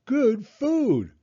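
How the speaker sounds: put-on high, loud child's voice; proud little girl. A narrator's reading. Little girl proudly presenting junk food to her father, high and loud. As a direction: beam as you show it off. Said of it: "Taya said".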